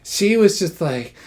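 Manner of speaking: ordinary talk, nothing marked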